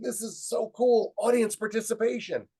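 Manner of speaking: high, excited exclamation; acting out mounting delight; thrilled. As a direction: marvel at it, giddy and loud. Acting out his thrilled thought.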